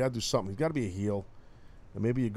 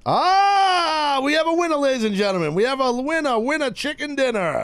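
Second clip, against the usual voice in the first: loud high game show announcer; 7 dB louder than usual. Real, not acted. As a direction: announce the winner big. Announcing the winner.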